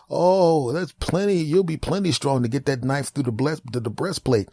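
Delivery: high, chirpy doctor voice; high cheerful doctor asking questions; high mock doctor. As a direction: breezily quiz the caller.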